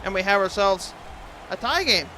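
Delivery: loud hockey play-by-play call; excited goal call. Acted. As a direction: call the tying goal with energy.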